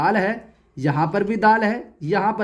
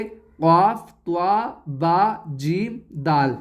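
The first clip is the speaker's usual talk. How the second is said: each letter drawn out on its own, with pauses between; letters said separately; 2 semitones lower, much slower than usual. Shown as a demonstration. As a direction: say काफ, त्वा, बा, जीम, दाल one at a time, slowly. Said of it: "अलग-अलग कहना चाहते हैं तो आप ऐसे कहेंगे". (अलग-अलग, ठहर-ठहर कर)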